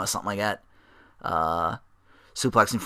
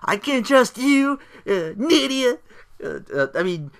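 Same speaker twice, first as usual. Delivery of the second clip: much higher, hammed-up furious rant; pissed off Jamie Noble; 12 semitones higher, 3 dB louder than usual. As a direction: rant like a betrayed hothead. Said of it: "I'm like".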